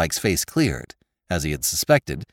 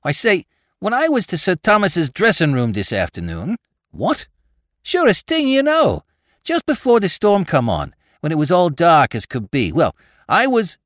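high and louder, tumbling out eagerly; rush of excited words; 8 semitones higher, 4 dB louder than usual. Acted. Spike gushing to his boss, excited rush. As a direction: gush it all out at once. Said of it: "excitement vented itself in a rush of words".

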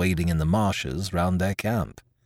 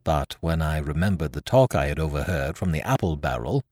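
ordinary delivery

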